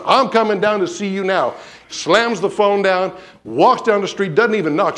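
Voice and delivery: ordinary talk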